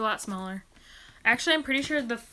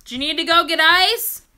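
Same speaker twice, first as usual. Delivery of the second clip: loud call, higher pitch; 7 semitones higher, 13 dB louder than usual. Real, not acted. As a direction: call out to someone upstairs. Calling to brother coming back.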